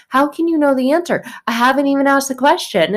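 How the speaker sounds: exasperated teacher. Retold or imitated. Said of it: "And you're like".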